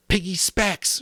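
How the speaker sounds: high, loud shout. A narrator's reading. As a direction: yell the order. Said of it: "shouted Ralph".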